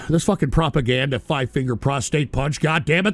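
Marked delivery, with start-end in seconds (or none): none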